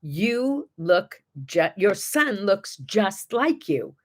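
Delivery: mild slow imitation of a thick Chinese accent; a lightly done thick Chinese accent, slow and careful. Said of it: "again, in a very thick accent".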